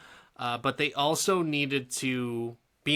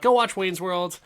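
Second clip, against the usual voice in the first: emphatic; 6 semitones higher, 7 dB louder than usual. Real, not acted. Emphatic recommendation.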